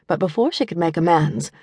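usual speaking voice

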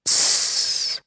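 soft unvoiced hiss; hissing tss. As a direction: let out a short hiss. Same noise again. (leises Zischen)

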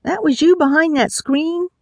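high-pitched, startled question; surprised. A narrator's reading. Gunman learning who he spoke to, surprised. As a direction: tough guy thrown off, voice jumping up. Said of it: "He sounded surprised and a little less scary".

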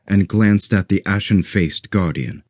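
ordinary delivery, nothing marked

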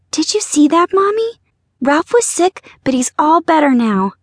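put-on high, delighted child voice; little girl voice. A narrator's reading. Girl telling her mother the fish recovered, high child voice. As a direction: chirp it like a small girl.

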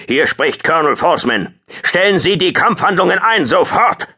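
high, forceful shouted order; booming command. A narrator's reading. Colonel ending the battle over the com, booming. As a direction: build from announcement to full shout. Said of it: "Ein Bass dröhnte in Hartfields Ohren".

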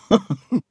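high nervous little laugh; relieved laugh. Acted. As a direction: laugh off the fright. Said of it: "sagte er schließlich lachend".

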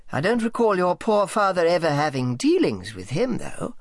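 well-fed businessman thinking it over helpfully; ponderous, helpful Higgs. A narrator's reading. Higgs recalls a ship's chandler for Sally, ponderously helpful.

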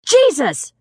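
high, falling yell of a name; sudden yell. A narrator's reading. Brandy stopping suddenly on the walk back, yelling. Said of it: "Brandy stopped suddenly and yelled".

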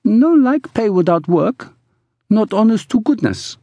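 put-on high accented woman's voice; protesting woman. A narrator's reading. Woman objecting to pay without work, high voice.

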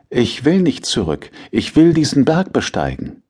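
put-on a stubborn kid begging his father; defiant boy. A narrator's reading.

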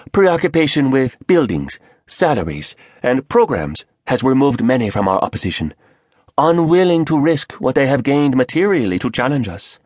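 quick, clipped Korean speaker; Korean accent. Acted. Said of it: "the Korean said".

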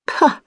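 dismissive pah, thrown high; sharp high scoff; scornful pah. A narrator's reading. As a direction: brush the compliment away with a high scoff. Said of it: "she says with a sweep".

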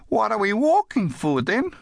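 put-on high-pitched child questioning Dad; little girl. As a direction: ask high like a small girl. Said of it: "The little girl".

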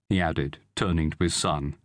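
plain reading voice

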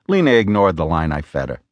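ordinary delivery, nothing marked

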